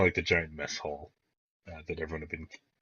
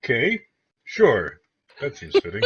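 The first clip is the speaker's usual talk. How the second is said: Jarrett, puzzled acceptance; 6 semitones higher, 6 dB louder than usual. Acted. Jarrett humors the group's new name, puzzled acceptance. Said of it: "gives you a weird look and goes".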